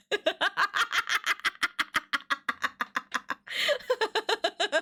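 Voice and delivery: high cackling laughing fit; real laughter, rattling on and on; long helpless laugh. Real, not acted. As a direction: crack up completely. Cracking up at the game.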